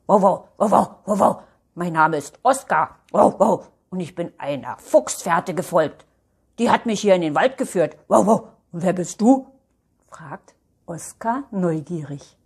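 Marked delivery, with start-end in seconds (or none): 0.1-9.5 loud barks between spoken introductions; talking dog introducing himself with woofs; friendly, barky dog character; curious dog. Acted.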